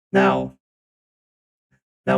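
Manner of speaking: normal talking voice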